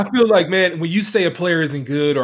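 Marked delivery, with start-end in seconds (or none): none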